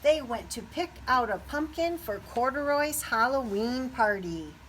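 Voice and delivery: usual speaking voice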